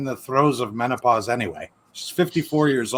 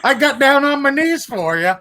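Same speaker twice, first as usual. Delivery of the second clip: mock earnest quote; 11 semitones higher, 4 dB louder than usual. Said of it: "She's like".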